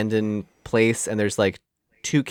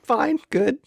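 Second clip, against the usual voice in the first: high squeaky fine. Pretend. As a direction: squeak it out in a tiny voice. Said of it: "the squeaky voice fine is exactly it".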